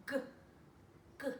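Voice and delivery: g, g from the throat; throat g sound. Shown as a demonstration. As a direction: click out a throaty g. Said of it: "I can feel that in my throat".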